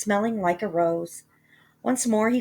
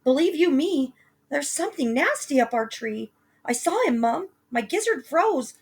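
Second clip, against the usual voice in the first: put-on higher, breathless scared boy; frightened boy; 8 semitones higher, 3 dB louder than usual. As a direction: blurt it out in fright. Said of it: "he gasped".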